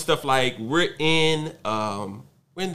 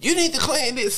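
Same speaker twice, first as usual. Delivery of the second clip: imitating wife; 10 semitones higher than usual. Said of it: "she be on me about".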